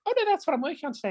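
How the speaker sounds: quilter's reply. Retold or imitated. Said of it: "And was like".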